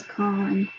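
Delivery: "convent" broken by a big pause after "con"; halting two-part "con... vent"; pause between syllables. Retold or imitated. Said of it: "a big kind of a pause in between".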